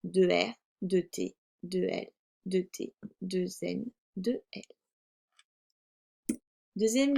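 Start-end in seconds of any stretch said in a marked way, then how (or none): none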